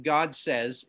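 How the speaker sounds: usual speaking voice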